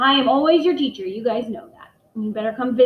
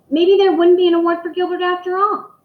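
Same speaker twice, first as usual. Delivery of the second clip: worried; 8 semitones higher, 7 dB louder than usual. A narrator's reading. Worried Gilbert thought.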